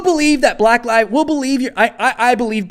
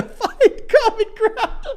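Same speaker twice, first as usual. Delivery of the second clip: laughing; much slower than usual. Real, not acted. Laughter breaks reading.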